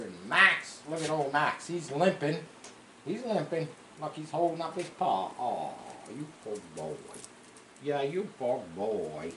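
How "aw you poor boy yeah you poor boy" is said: pitying the dog. Real, not acted.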